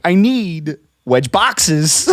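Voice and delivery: very slow deadpan Walken line; Christopher Walken impression. Shown as a demonstration. [name] showing the Walken voice he did at work. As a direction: draw it out with odd stress. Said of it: "and I was like".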